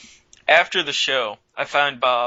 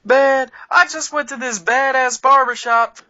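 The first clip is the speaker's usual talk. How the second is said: put-on much higher and louder, bragging drunk voice; proud drunk; 10 semitones higher, 6 dB louder than usual. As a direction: boast like a wasted buddy, pitched way up. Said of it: "He goes".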